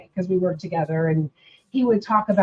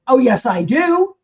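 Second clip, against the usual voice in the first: louder, higher mock-indignant insistence; indignant retort; 5 semitones higher, 6 dB louder than usual. Retold to an atheist friend, louder mock indignation. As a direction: snap back with offended certainty. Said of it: "And I went".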